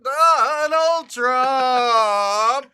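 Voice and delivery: long, high, drawn-out wail; hammed-up loud, stretched shriek; mock electrocuted cry. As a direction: howl the name as if zapped. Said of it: "we will at some point get buzzed".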